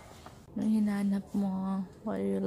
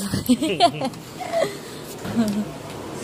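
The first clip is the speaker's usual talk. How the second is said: loud laughter; 5 semitones higher, 6 dB louder than usual. Real, not acted. Bursts out laughing after teasing.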